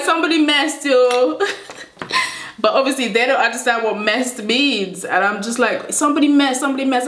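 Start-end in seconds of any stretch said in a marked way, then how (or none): 0.0-1.4 high-pitched playful accusation; re-enacted playground outcry; mock announcement. Acting out her childhood accusation. 5.9-7.1 high-pitched repeated protest; playful re-enacted accusation; imitated insistence. Insisting to uncomprehending classmates.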